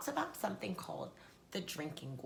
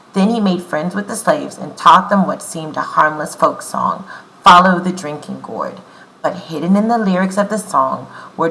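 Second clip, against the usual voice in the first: loud, clear read-aloud for the class; story reading; 2 semitones lower, 23 dB louder than usual. Acted. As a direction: read the book out to the children. Reads the book aloud.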